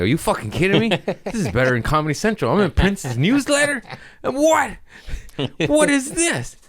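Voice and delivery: a fan who can't believe his luck; over-the-moon disbelief. Retold or imitated. Telling the staff he can't believe he's in [name]'s newsletter, shrieking with delight.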